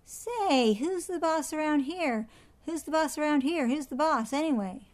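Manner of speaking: high, loud, bossy parrot squawk; pushy parrot calling out; parrot yelling. A narrator's reading. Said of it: "Penelope yelled out".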